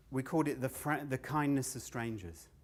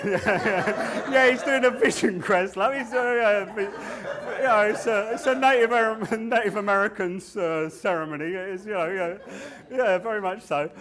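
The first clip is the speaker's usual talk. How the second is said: loud, high, laughing cover story; mock excuse; 9 semitones higher, 12 dB louder than usual. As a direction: fob off an imagined cop.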